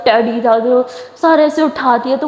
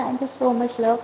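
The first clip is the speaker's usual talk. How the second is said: soft thanks; 8 dB quieter, much slower than usual. Acted. Hugging her friend tenderly.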